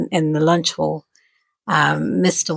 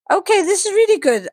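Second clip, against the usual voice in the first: excited high voice; 13 semitones higher than usual. Retold or imitated. Teacher excited on phone.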